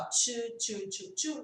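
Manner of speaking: "to" repeated as "chu, chu, chu"; puckered CH drill. Shown as a demonstration. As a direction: pucker the lips and turn "to" into "chu". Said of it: "e fazer bico".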